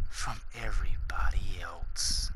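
dramatic whisper. Acted. Vlogger boasts to viewers he is different, whispered. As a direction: drop to a hushed whisper for effect.

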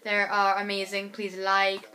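ordinary talk

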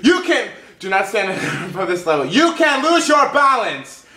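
giggly mock-shouted warning; laughing caps read. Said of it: "it's all in caps".

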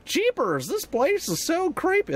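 high, drawn-out cartoon spookedness; hammed-up creeped-out cartoon voice, pitched high; Scooby-Doo style jitters. Player entering a creepy place, high cartoon jitters. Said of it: "Thanks, Scooby-Doo".

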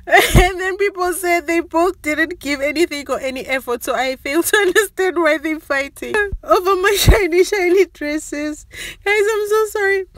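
high, giggling, barely holding it together; laughing while talking. Real, not acted. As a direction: talk through fits of laughter. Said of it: "I can't stop laughing".

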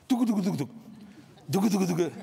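mouth-made turbulence rattle; turbulence noise. Retold or imitated. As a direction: bounce it out like a shaking cabin. Imitating turbulence again.

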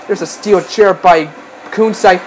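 ordinary talk, nothing marked

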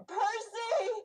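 high, loud, drawn-out cry; screamed name. Acted. Player's character calls to Percy's departing spirit, screamed. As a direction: scream out to a lost friend. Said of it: "I just, I scream".

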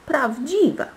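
high-pitched, appalled echo; scandalised echo. A narrator's reading. Court ladies appalled that the rose is real, scandalised echo.

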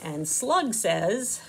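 plain reading voice

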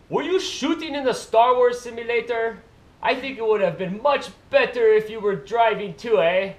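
loud, high-pitched mock Canadian accent; bad Canadian accent. Vlogger reading a viewer comment aloud, bad Canadian accent. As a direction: put on a hammed-up Canadian accent. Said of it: "Is that your Canadian accent?".

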